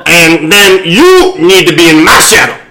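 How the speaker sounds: loud nasal preacher impression; Jesse Jackson preaching. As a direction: boom it through your nose. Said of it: "a Jesse preach".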